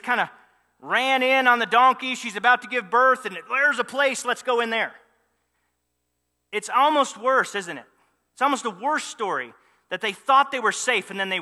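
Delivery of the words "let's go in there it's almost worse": usual speaking voice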